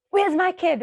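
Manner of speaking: put-on high, loud anxious call for a lost child; worried mother shouting across the park; panicked call. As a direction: call out for your kid in a panic. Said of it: "And here I would be like".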